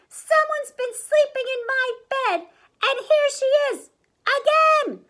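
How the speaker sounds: loud, very high little voice; wailing baby bear. A narrator's reading. Baby Bear finds Goldie in bed, squealing wail. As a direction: build to a squealed final word. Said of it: "he wailed".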